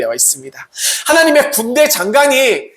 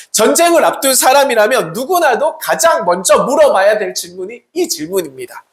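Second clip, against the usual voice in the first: usual speaking voice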